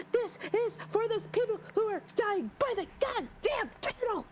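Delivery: squeaky mocking character; high mock voice. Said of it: "she's just like".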